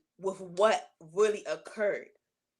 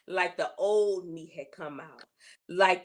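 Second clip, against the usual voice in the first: usual speaking voice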